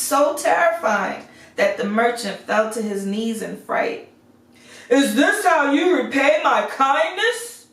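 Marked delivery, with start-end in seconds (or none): 4.9-7.7 high, loud, roaring beast voice; booming beast. A narrator's reading. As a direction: boom it out as an angry beast. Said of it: "boomed the beast".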